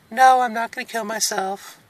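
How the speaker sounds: usual speaking voice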